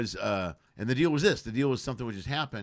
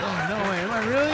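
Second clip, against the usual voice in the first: high, loud, amazed mock delight; hammed-up giddy astonishment; mock surprise; 10 semitones higher, 7 dB louder, faster than usual. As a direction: gush it like the victim can't believe it. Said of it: "He's like".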